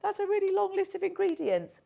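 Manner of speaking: high, loud shocked yikes; dismayed. Retold or imitated. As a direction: recoil at the long list. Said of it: "you look at and think".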